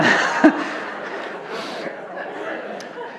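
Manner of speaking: soft airy chuckle at his own joke; quiet breathy laugh. Real, not acted. Pastor laughing at his own cheesy joke, soft and airy. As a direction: let out a breathless little laugh.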